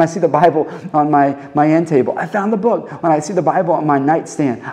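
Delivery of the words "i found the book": high-pitched, delighted exclamation; excited discovery. Acted. Preacher imagines spotting the Bible on his end table, excited. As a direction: announce the find with a thrill. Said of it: "give me that kind of excitement".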